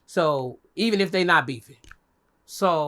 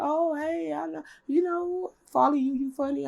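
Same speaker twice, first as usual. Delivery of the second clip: much higher, slower fan voice; high girly driver; 10 semitones higher than usual. Retold or imitated. Lupe the driver greeting her fan, high and slow. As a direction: coo it like a starstruck fan. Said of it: "she was like".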